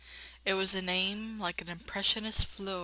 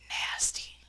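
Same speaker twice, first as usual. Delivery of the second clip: whispered nasty. Real, not acted. Aside on the plague.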